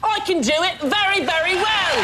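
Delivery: put-on high, hammy London cockney accent. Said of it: "Can you do a London accent?".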